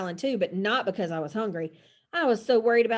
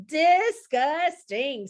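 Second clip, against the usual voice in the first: very slow, high, syllable by syllable; disgusted; 6 semitones higher, 3 dB louder, much slower than usual. A narrator's reading. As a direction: draw out each syllable in revulsion. Chloe grossed out by friends.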